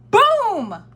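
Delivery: high, loud storybook crash word; loud boom. A narrator's reading. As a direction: burst out with the crash. Sound word for Santa landing.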